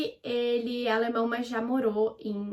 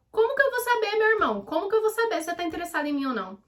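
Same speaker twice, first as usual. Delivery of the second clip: high, insistent mock exasperation; exasperated outburst; 8 semitones higher, faster than usual. Venting at people's vague invitations, exasperated. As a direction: burst out high, then rush through the complaint.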